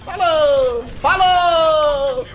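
loud, high stretched farewell; drawn-out taunt. Real, not acted. Streamer mocking a beaten enemy, drawn-out taunt. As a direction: sing out a gloating bye.

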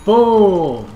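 loud high boom; triumphant shout. Real, not acted. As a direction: yell it in triumph.

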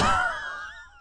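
high breathy shriek of laughter. Real, not acted. Viewer shrieking with laughter. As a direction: burst into a squealing laugh.

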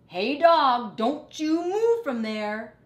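loud, high rat voice calling over; loud bossy rat call. Acted. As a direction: call across the junkyard, bossy. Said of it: "called Rat".

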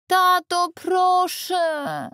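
put-on a little boy whining to his dad; boy tugging at his father's sweater, pleading; begging child. A narrator's reading.

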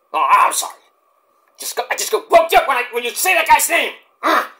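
a hint of wrestler fury; Mike Awesome impression. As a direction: grumble like a riled-up wrestler. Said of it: "my Mike Awesome impression".

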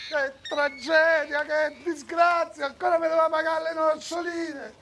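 a fake tragic outcry; mock lament. Inspector's mock grief at death. (lamento teatrale finto, acuto e lento)

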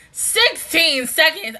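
loud, disbelieving outburst; real astonishment. Real, not acted. Viewer reacting to sixteen seconds on screen, loudly astonished. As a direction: blurt it out loud in surprise.

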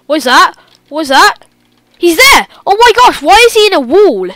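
loud, high startled cries; jumping in fright at him; panicked. Real, not acted. Sees Slender suddenly.

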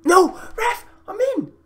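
very high, squealed appeal; outraged protest. Real, not acted. Gamer denied when through on goal, outraged. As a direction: shriek it at the referee.

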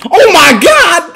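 high, drawn-out yell; loud, stunned outburst; shocked shout. Real, not acted. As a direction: scream it out, stretching the words. Reacting to a big play.

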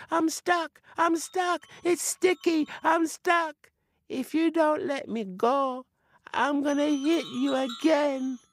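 high spirit voice, fretful and stuck; a high little spirit whining it can't get free; stuck, complaining spirit. Acted. Spirit stuck on sticky doll.

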